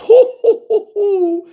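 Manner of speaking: high mock villain cackle of hoo hoo hoo; villain laugh. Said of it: "making their One Piece laughs".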